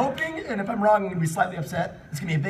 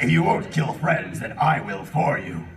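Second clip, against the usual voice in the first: a put-on character voice, lower; hammed-up villain voice; 8 semitones lower than usual. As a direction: deepen the voice for a villain line. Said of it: "I'm glad I'm doing that voice".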